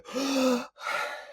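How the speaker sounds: high gasp for air after coming up; sharp gasp. Pretend. Mind surfacing from the water, gasping for air.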